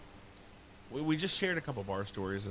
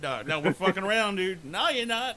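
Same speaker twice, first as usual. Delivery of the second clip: mock argument; 9 semitones higher, 7 dB louder than usual. Imagined argument over the prank.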